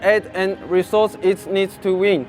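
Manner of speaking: measured speech from the podium; formal speech. Acted. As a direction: read it like an address to a crowd. Delivers a rally speech.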